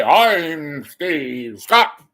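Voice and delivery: loud, stretched-out announcer sign-on; hammed-up slow boom on the name; Steve Scott. Host parodies a newscaster's introduction, drawn-out pompous boom. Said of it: "quit talking like this".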